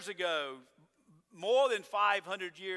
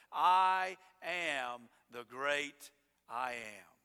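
very slow, lower, every word weighed; solemn divine declaration; 6 semitones lower, much slower than usual. Acted. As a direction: lay it down like a proclamation from heaven.

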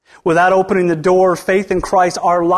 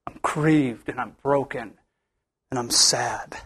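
a hushed, grieving whisper to God; a pastor's prayer of grief, whispered low and broken; 8 semitones lower, 8 dB quieter than usual. Retold or imitated. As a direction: let the words fall to a lower, breathless whisper.

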